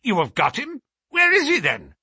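high, loud astonished questioning; amazed. A narrator's reading. Colonel demanding where the murderer is, astonished. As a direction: burst out in amazement, pitch leaping up. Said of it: "stared at him in amazement".

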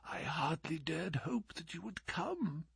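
very soft faint whisper. A narrator's reading. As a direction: barely voice it, weak and hopeful. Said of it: "Holmes whispered".

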